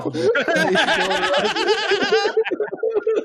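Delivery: high, sustained burst of laughter; uncontrolled giggling fit. Real, not acted. Reacting to stabbing clip.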